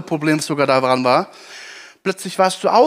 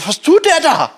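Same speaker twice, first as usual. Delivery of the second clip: high, loud astonished question; a leper amazed at Jesus' touch; stunned leper; 11 semitones higher, 5 dB louder than usual. Retold or imitated. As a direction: disbelief that he is touched. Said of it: "Der Aussätzige muss denken".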